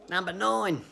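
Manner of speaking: loud, high call to the whole cellblock; yelled out. Retold or imitated. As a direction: shout it like an inmate calling out a number. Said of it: "someone yelled out".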